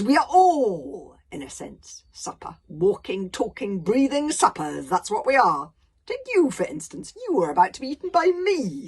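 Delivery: loud, slow, grand monster voice; a giant savouring every word of his philosophy; pompous dragon philosopher. Acted. Dragon lecturing Hiccup on life, loud and grandiose.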